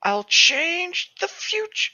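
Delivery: slow, high, hammed-up vow; dramatic villain vow. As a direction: declare it like a grand villain, drawn out. Vows dramatically while reading romance.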